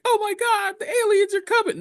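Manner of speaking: high mock-panicked screech. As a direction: squeal in fake terror. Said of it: "So we're like".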